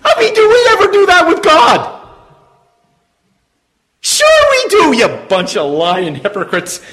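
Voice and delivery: loud, high, ringing preacher voice; full-force preaching. Acted. Preacher calling out to his congregation, full-force preaching. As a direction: preach it out to the whole room.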